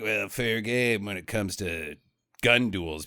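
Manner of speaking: lightly voiced slow, low gunslinger; laconic gunfighter. Gunfighter answering the brother, lightly slow and low.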